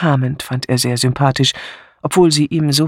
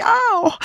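mock ouch. A narrator's reading. Recalls pain on her corns.

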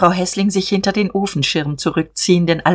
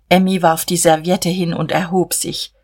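plain reading voice

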